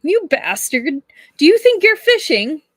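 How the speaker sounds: high, loud mock outrage; indignant outburst. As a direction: yell it like a fond insult. Liu scolds the prince playfully.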